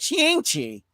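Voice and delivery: Shang-Chi said as SHING-chee; Midwestern mispronunciation. Retold or imitated. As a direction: turn the vowel of Shang-Chi into a short "ih". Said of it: "in the Midwest, everybody's just gonna call it".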